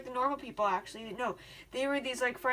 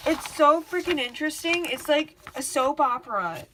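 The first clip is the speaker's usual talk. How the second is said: loud, raised-pitch enthusiasm; hyped up; 3 semitones higher, 5 dB louder than usual. Real, not acted. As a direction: gush loudly about a favorite subject. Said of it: "You know why I get hyped up".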